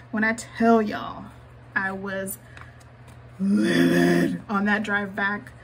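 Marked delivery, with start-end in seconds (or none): 3.4-5.5 loud, slow, seething emphasis; livid. Shown as a demonstration. Telling her viewers how mad she was, loud and slow. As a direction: spit the words out livid. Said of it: "I was so angry".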